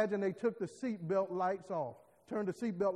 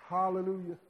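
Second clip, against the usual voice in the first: ordinary delivery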